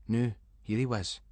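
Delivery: plain reading voice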